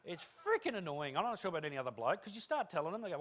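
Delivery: normal talking voice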